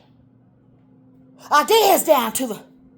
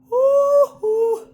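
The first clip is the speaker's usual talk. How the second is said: high hoots; 10 semitones higher, 4 dB louder than usual. Real, not acted.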